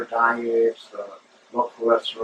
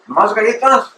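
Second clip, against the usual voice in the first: "¿Más galletas?" echoed higher and louder, incredulous; mock disbelief; 10 semitones higher, 6 dB louder than usual. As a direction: echo "¿Más galletas?" as a stunned vendor. Vendor lady echoes in disbelief.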